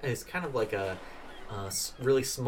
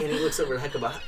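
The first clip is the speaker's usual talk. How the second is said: usual speaking voice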